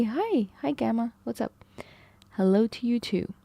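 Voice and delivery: ordinary talk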